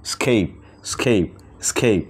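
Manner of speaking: স্কেপ said slowly as skeip, drawn out; teacher models স্কেপ slowly for students; word pronounced slowly. Shown as a demonstration. Teacher demonstrates to students, slow careful skeip. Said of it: "এটাকে বলা হচ্ছে স্কেপ".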